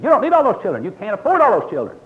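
high, loud imitated scolding; mock scolding mother. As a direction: chew him out like an exasperated mom. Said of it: "She said, she'd really chew me out".

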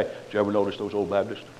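low, soft, fast mock gossip about Baptists; mocking sneering preacher. Imitating preachers mocking old Baptists, low and sneering. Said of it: "And then these preachers say".

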